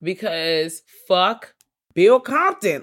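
drawn-out, loud exasperation at Bill; exasperated outburst. Real, not acted. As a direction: spit the name out in disgust. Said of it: "exasperated outburst".

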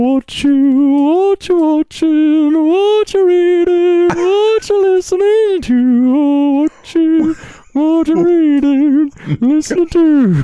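loud high slow yell of the jingle. Retold or imitated. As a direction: belt the questions out high. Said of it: "just yell".

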